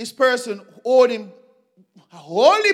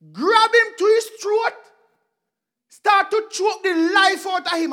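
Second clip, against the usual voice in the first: violent telling; 7 semitones higher, 4 dB louder than usual. Acted. Acting out choking scene.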